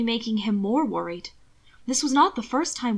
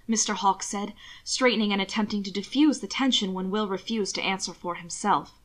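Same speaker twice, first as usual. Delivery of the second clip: ordinary delivery, nothing marked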